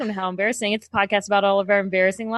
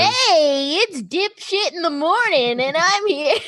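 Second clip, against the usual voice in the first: shock jock voice; 6 semitones higher, slower than usual. Pretend. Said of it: "I feel like a real, like, a shock jock".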